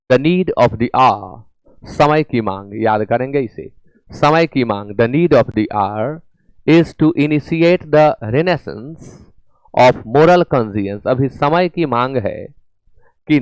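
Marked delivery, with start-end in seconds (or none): 0.1-1.4 slow reading. Shown as a demonstration. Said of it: "reads question sentence". 4.9-11.1 very slow English, word by word; measured classroom reading; slow dictation reading. Shown as a demonstration. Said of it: "reads question sentence slowly".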